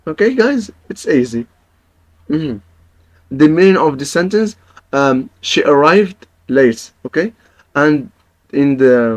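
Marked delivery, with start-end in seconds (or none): none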